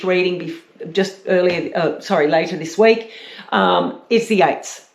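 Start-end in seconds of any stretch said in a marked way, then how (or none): none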